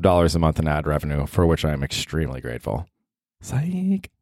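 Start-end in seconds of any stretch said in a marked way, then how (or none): 3.4-4.1 sarcastic psych. Pretend. Sarcastic reveal after ad boast.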